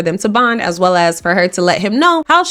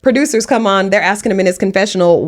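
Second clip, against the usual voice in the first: usual speaking voice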